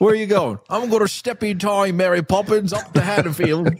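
high, Mary Poppins style Cockney; mock Cockney.

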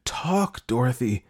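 high-pitched, contained self-urging; mild frantic inner cry; inner scream. A narrator's reading. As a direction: order yourself sharply, without shouting. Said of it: "she screamed in her head".

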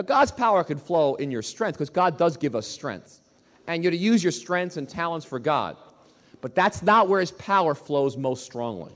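normal talking voice

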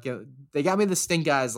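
usual speaking voice

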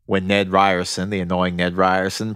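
ordinary delivery

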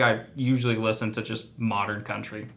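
ordinary talk

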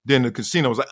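ordinary talk

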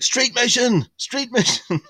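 high, loud yell from the crowd; a fan shouting up at a band; shouted song request. Retold or imitated. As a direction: bellow it at the stage like a mad fan. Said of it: "shouting stuff like".

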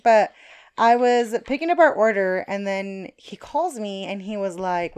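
ordinary talk

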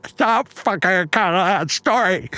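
high, mangled speech through a propped-open mouth; mouth stretched open. Acted. Patient trying to speak mid-dental work, mouth stretched open. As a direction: try talking with your jaw stuck open.

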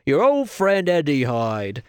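slow mock Cockney villain; weird Cockney accent. Reviewer presenting Hyde's transformation, hammed-up weird Cockney accent. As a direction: ham up a bad Cockney accent. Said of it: "weird things in a weird accent".

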